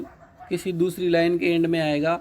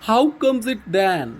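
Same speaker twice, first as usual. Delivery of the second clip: drawn-out, lifted reading of "How comes it then"; slow, deliberate poem reading; slow poem recitation; 5 semitones higher, 5 dB louder, much slower than usual. Shown as a demonstration. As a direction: recite slowly, opening a touch louder.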